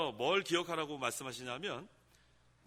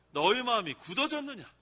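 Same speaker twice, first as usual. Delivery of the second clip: sharp, raised reproach; a rebuke, voice a touch higher; rebuking; 5 semitones higher, 5 dB louder than usual. Shown as a demonstration. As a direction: press the question like a scolding. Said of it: "또 뭐라고 책망하세요?".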